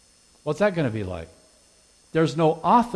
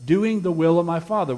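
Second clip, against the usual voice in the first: "doing" punched louder and higher, then falling; "doing" is the word that carries the whole line; stress on doing; 4 semitones higher, 6 dB louder than usual. Shown as a demonstration. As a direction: hit the word "doing" hard. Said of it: "Notice the emphasis".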